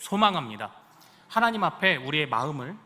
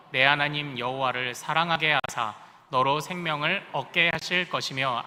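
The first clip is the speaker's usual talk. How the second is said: scripture read in unison; 4 semitones lower than usual. Acted. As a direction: read it together with the church. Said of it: "같이 읽습니다. 시작".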